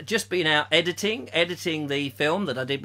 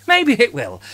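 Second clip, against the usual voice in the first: Maybe it will said higher and louder; emphatic repeat; 5 semitones higher, 4 dB louder than usual. Shown as a demonstration. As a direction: land the "Maybe it will" firmly. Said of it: "repeated louder".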